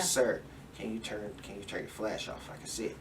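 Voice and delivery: soft, polite request; quietly polite, like asking a favour; asking nicely. Retold or imitated. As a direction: gently ask him to turn it off. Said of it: "All nicely".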